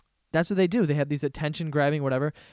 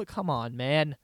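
normal talking voice

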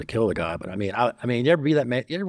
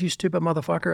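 higher, heated cursing; angry insult; 8 semitones higher, faster than usual. Retold or imitated. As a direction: spit the insult out. Retold scolding of partner.

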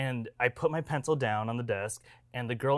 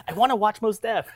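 imitating eager date; 9 semitones higher, 7 dB louder than usual. Retold date ignoring him, whining eagerly.

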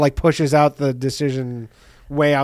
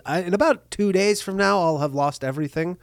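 soft, unruffled prediction; quietly matter-of-fact retelling; calm; 7 dB quieter than usual. Retold or imitated. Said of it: "And he says it so calmly".

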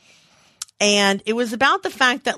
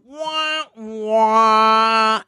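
mock sad trombone wah-wah; 3 dB louder than usual. Describing the Debbie Downer skit, she mimics the sad trombone. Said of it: "guy in the band would go".

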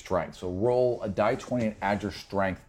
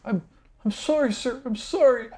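cowering apology. Acted. NPC begging after being hit.

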